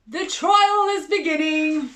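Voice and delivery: a herald announces the trial in a loud high shout; shouted announcement. A narrator's reading. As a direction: boom it out to the whole court. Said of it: "a voice shouted".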